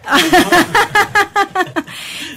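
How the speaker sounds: a bright burst of real laughter, falling away. Real, not acted. Host laughing at her co-host's timid greeting, amused laughter. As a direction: laugh at the shy little hello.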